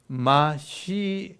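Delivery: 마시아흐 slowly, ending in a rasped throat-clearing h; suono gutturale. Shown as a demonstration. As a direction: draw out 마시아흐, scrape the final 흐 like bringing up phlegm. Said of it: "가래 뱉을 때 나는 소리".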